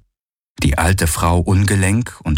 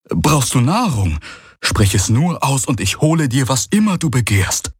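eager servant; 7 semitones higher than usual. Acted. Young servant offers eagerly.

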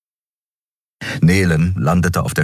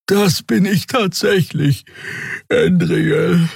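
high, sleepy creature voice; tired Draxül; 13 semitones higher, slower than usual. A narrator's reading. Xea admits to Endriel she is tired, weary Draxül voice. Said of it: "riss ihren Schnabel zu einem Gähnen auf".

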